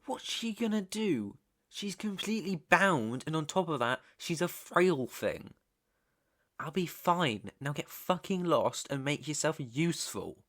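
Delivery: high, exasperated rant at an underling; annoyed boss brushing off a subordinate; irritated dismissal. Acted. Snaps at underling to get lost.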